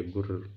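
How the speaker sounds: low menacing growl. Acted. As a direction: growl like a beast. Ghost growls.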